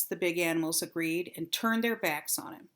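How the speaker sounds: ordinary delivery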